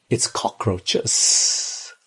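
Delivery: slow breathy whisper of dread; hushed, slow horror at cockroaches. Real, not acted. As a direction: drag the word out as if it disgusts you.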